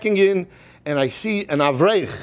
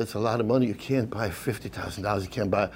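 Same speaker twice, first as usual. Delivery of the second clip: put-on elderly rabbi; 9 semitones lower, 6 dB quieter than usual. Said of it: "Rabbi Yosef says".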